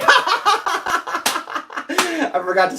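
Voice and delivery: slow, laughing it out. Real, not acted. Admitting to viewers he forgot to smell it, laughing. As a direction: chuckle through the admission.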